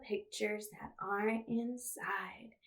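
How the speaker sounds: soft whispered line; hushed storybook whisper. Acted. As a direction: whisper it as if revealing a secret. Reading to kids, hushed.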